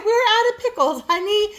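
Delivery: high sugary imitation of her; imitating her high, sweetly; mock sweet reply. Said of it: "she said".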